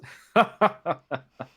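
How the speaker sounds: high, loud burst of laughter; booming amused laugh at the joke. Acted. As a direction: let out a big amused boss laugh. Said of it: "Hong Long just laughs out loud".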